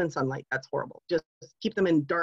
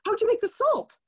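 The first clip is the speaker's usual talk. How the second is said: mock disbelief; 12 semitones higher than usual. Mocking seller of organic salt.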